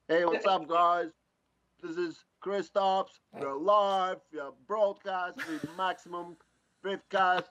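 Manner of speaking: loud, high, hammed-up livestream host; Von Gittin Junior. Shown as a demonstration. Driver following the host's lead, loud hyped presenter impersonation. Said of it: "do an impersonation of Von Gittin Junior".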